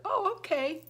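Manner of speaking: high-pitched easy acceptance; agreeable husband. Retold or imitated. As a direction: mimic a husband cheerfully going along. Voices husband's agreeable reply again.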